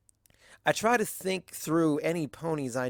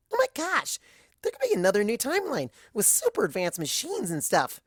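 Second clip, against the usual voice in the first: high, bubbly gasp into rapid excitement; excited gasp; 8 semitones higher than usual. Acted. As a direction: gasp like you just had the best idea ever. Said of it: "Pinky gasps".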